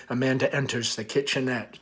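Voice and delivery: ordinary delivery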